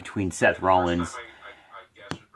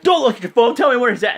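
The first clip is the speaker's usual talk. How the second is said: loud, high, frantic mock yell; freaking out; 13 semitones higher, 7 dB louder, much faster than usual. Seth Rollins yells at a guy with a phone, imitated freak-out. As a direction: imitate someone shouting orders in a rage. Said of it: "and he's freaking out".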